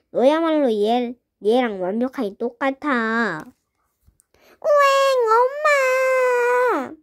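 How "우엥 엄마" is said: wailing high and drawn out for mom; crying. Acted. Little girl bawling after being teased. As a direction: bawl like a hurt little kid.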